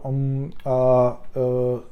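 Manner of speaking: drawn-out hesitation noises; halting "um, uh" as if searching for words; filler sounds. Shown as a demonstration. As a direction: demonstrate stalling with "um, uh". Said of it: "I do a lot of".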